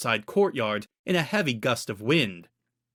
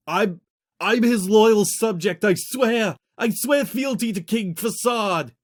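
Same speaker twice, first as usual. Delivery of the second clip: high, loud, slow strangled swearing; choking plea; 9 semitones higher, 5 dB louder, slower than usual. A narrator's reading. Prisoner in a chokehold swearing loyalty, high strangled plea. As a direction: gasp out the oath while being choked. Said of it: "Guma gagged, unable to free himself".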